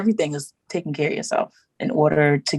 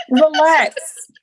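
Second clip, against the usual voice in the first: emphatic relax; 9 semitones higher, 6 dB louder than usual. Retold or imitated. Urging herself to slow down.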